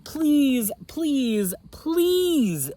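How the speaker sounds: high, loud pleading please; begging. Acted. Red begs Blue to race, high and pleading. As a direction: beg like an eager child. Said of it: "begged Racer Red".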